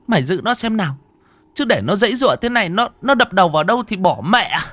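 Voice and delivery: high, loud, rushed orders; frantic grandmother yelling over a fit; urgent shouting. Acted. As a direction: bark at them to hold the boy down, speeding up. Grandma orders holding seizing child.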